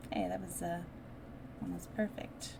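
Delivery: ordinary delivery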